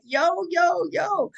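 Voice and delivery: high-pitched playful Brooklyn imitation; teasing Brooklyn "yo", sung out high; Brooklyn accent. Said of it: "'cause he has that Brooklyn accent".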